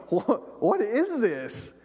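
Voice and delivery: slow, high bewildered question starting to crack into a laugh; drawn out, amused confusion; curious and half tickled; puzzled. Retold or imitated. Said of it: "he starts going".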